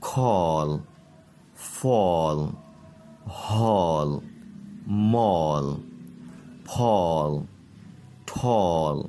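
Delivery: all, ball, call said slowly with a long "aw" sound; model word list. Shown as a demonstration. Teacher reads the list slowly to the class, each with an open "aw". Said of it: "ان کو آپ اچھی طرح سے سن لیجئے".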